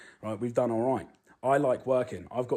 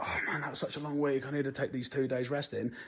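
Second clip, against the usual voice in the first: higher-pitched imitation of a moaner; mock whining; 4 semitones higher, 3 dB quieter than usual. Man mocks a lazy forty-hour worker, mock whining. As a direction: whine like a worn-out lazy worker, mockingly.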